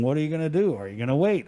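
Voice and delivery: ordinary talk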